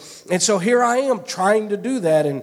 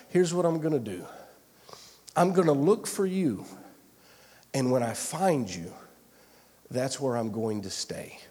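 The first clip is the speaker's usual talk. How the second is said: a retold vow, sinking lower and softer; quiet resolve; 4 semitones lower, 5 dB quieter than usual. Preacher retells his vow to look for God, quietly resolved.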